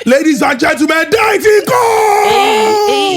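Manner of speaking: high ring-announcer shout, name stretched out long; hype announcer. Acted. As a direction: announce the star, holding the last word. Hype introduction of co-host.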